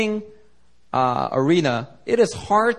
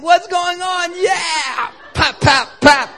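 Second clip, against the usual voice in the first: high, delighted shouting while punching; mock excited cheer; 5 dB louder, much slower than usual. Brian cheering himself while hitting Tyson, high mock shout. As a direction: whoop each punch like a giddy kid.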